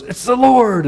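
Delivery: a disciple's thrilled shout of recognition; excited recognition. Retold or imitated. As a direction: blurt out the discovery. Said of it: "John goes".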